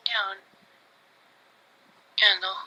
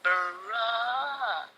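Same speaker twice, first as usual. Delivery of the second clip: long drawn-out, softer groan of a word; retold dismay; 4 semitones higher, 6 dB quieter than usual. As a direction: stretch it out in disbelief. Retells dismay at brother's remark.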